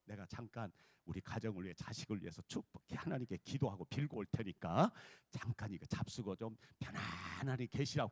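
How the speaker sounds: low, measured note-reading; wife's letter voice. Retold or imitated. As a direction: read it out as a humble wife's note. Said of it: "편지를 딱 써놨어".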